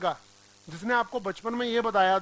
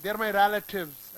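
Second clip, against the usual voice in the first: they are my relatives said slowly, relatives with रै; slow model sentence; much slower than usual. Shown as a demonstration. Teacher modeling the sentence slowly for students. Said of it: "ऐसे बोलते हैं".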